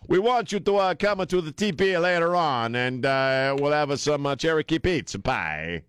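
hammed-up Italian waiter voice; Italian accent. Mocking a TV Indian chief with an Italian accent. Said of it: "I'm doing the wrong accent".

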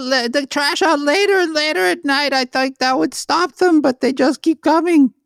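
a silly character complaining about sewage; high comic neighbour voice. Pretend. Future neighbour character in falsetto.

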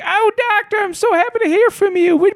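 put-on very high sweet old lady voice; high doting grandma voice; sweet old wife. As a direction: gush like a delighted elderly wife. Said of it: "she was like".

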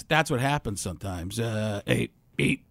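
ordinary talk

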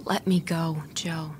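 frightened whisper; whispered plea. Acted. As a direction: beg in a bare whisper. Georgia pleads under her breath.

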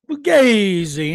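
Fugazi drawn out slow and loud in an Italian accent; Italian-accented verdict. Real, not acted.

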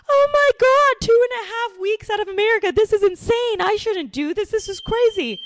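high squealed overexcited panic; mock freak-out. First-time vacationer freaking out about leaving America, high mock panic. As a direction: shriek it like a hammed-up first-timer losing it.

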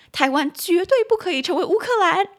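high, emphatic mainland Chinese accent. Shown as a demonstration. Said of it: "一直很想用中国的发音呐".

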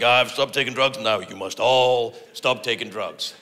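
mock evangelist, low, drawn out at the end; evangelical. Convert lecturing everyone to stop taking drugs, mock evangelical. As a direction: lightly preach it like a zealous convert. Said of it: "they get very evangelical".

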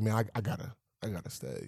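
low, very soft admission; sinking to a near-murmur; retold reluctant resolve. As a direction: say it as if to yourself. Retelling his torn private decision.

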